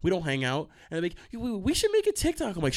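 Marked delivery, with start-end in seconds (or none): 1.3-2.6 high-pitched, hammed-up eagerness; mock eager classmate. Classmate asking for a TikTok, mock excited high voice. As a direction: imitate a hanger-on pitching an idea.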